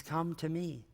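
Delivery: softly voiced invitation, hushed; gentle near-whisper. Jesus invites us today, hushed and gentle. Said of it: "he still whispers it to us today".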